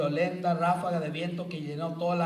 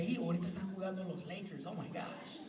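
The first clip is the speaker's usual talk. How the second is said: fast, softer thought aside; distracted inner voice; 12 dB quieter, faster than usual. Praying person's mind drifting to the Lakers game, distracted. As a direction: mutter it like a wandering mind. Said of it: "Tu mente dice".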